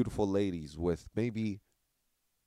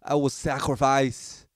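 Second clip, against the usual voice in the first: loud, high heroic dying plea; overacted death scene; 6 semitones higher, 9 dB louder than usual. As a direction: ham up a noble last sacrifice. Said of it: "I'll go".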